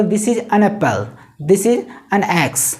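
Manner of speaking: "an" said as "un", slowly, word by word; slow example sentences. Shown as a demonstration. Teacher modelling example sentences for students. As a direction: say "an" as a short "un", unhurried. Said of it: "इस तरह से बोलना है".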